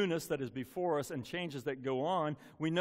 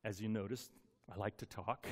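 ordinary talk, nothing marked

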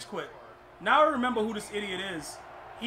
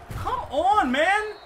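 higher and louder, pleading exasperation; frustrated yell; 10 semitones higher, 7 dB louder, much slower than usual. Real, not acted. Gamer protesting the game's defense, exasperated shout. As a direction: throw the words at the TV in disgust.

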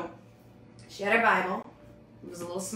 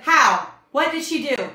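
sharp, loud demand; raised, forceful questioning; commanding interrogation; 6 semitones higher, 10 dB louder than usual. Retold or imitated. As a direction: imitate a minister grilling a demon. Minister interrogates the demon.

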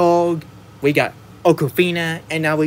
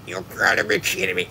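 lightly voiced Plankton, a bit higher and softer; Plankton quote; 5 semitones higher, 4 dB quieter, faster than usual. Reviewer quoting Plankton's line, lightly voiced and higher. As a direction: toss off Plankton's line in a half-put-on voice. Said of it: "and when Plankton saying".

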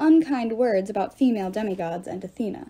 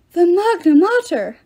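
louder and higher, slow wail; despairing wail; 8 semitones higher, 8 dB louder than usual. A narrator's reading. Ghost wails in despair at the Great Mother. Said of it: "a ghost wailed in despair".

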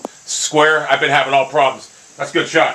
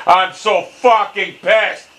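higher, slow angry outburst; pissed off, building; 4 semitones higher, much slower than usual. Real, not acted. Gamer repeating how pissed they are, angrier. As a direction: stretch each word out in real rage.

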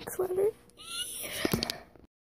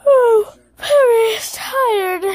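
pet voice; 8 semitones higher, 5 dB louder, slower than usual. Pretend. Voicing the pet dog.

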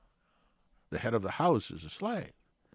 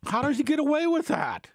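very high, loud, disbelieving squawk; incredulous outcry; 5 dB louder than usual. Acted. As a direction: cry it out high-pitched in exaggerated disbelief. Outraged rhetorical question about the pastor.

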